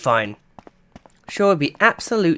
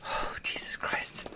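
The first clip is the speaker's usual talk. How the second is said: slow breathless whisper of relief; whispering after nearly dying; shaken whisper; 15 dB quieter, slower than usual. Real, not acted. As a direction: whisper it, still rattled.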